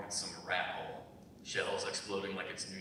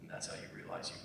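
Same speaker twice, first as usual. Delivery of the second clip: low and hushed, trailing off; subdued quiet; 3 semitones lower, 9 dB quieter than usual. Acted. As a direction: let the memories pull the voice down to almost nothing. Old soldier recalling war, subdued.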